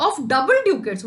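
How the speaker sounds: high, loud cry; mock wail. Teacher acts Shylock crying out, mock wail. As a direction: wail over the doubled coins. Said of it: "फिर उसने बोला".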